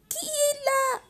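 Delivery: granny asks who knocks, high and thin; old grandmother. Acted. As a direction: call through the door like a frail granny. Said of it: "demanda la vieille dame".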